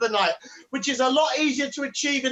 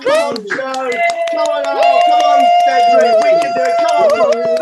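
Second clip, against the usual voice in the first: much higher, louder rallying cry; crowd hype shout; 10 dB louder than usual. Real, not acted. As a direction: rouse the audience to cheer. Compere whipping up crowd.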